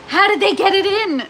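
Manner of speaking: high, loud, baffled question; exasperated. Real, not acted. Streamer asks viewers how the lid goes, high and exasperated. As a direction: puzzle over it, loud and exasperated.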